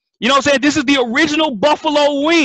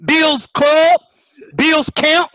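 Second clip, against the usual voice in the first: usual speaking voice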